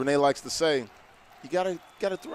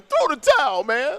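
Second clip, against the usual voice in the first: called out higher and louder; retold call to stop the fight; 12 semitones higher, 6 dB louder than usual. Cohost retells telling them to end the game, called out loud. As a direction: shout to throw in the towel. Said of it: "I kept saying".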